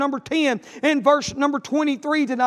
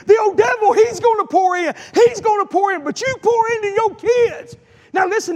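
fiery preaching; 6 semitones higher than usual. Real, not acted. Preacher urging parents to pour into their kids, shouting. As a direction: let the sermon build to a shout.

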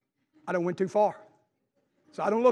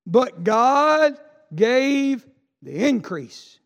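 slow, drawn-out, loud verse; slow proclaimed scripture; 3 semitones higher, 6 dB louder, much slower than usual. Acted. Preacher proclaiming Corinthians, slow and loud. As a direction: stretch every word of it.

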